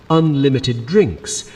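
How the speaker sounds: plain reading voice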